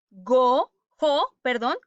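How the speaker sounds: ordinary delivery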